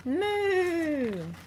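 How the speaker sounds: a high, stretched cow moo; playful mock moo; cartoon moo. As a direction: moo up at the bird, high and long. Puppy moos at the bird.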